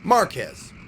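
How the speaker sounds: MAR punched in Marquez, falling after; stress on first syllable. Shown as a demonstration. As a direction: say Marquez with emphasis on the AH. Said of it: "emphasis on the first syllable".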